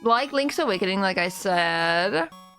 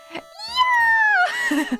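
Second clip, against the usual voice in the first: high excited yelp; excited cry; 4 dB louder than usual. Real, not acted. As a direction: squeal with glee.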